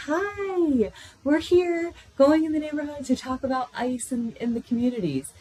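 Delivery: bright, high, bubbly canvasser greeting at the door; cheerful and chipper. Retold or imitated. Canvasser greeting an older woman at her door, high chipper voice. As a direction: sing it out sweetly to a stranger. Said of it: "cheerful and chipper".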